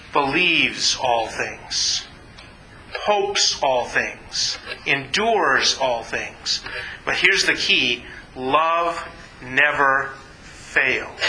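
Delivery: hushed, reverent reading; solemn. Acted. Preacher reading scripture to his congregation, slow and hushed.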